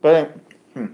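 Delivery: talking through a mouthful; mouth full. Real, not acted. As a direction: hmm with food in mouth. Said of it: "my mouth is full".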